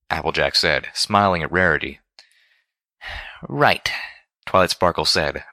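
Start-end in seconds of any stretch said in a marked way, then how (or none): 3.4-3.9 high, falling "right"; firm. A narrator's reading. Twilight regaining her footing, firm. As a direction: take charge again. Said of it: "back on firmer ground".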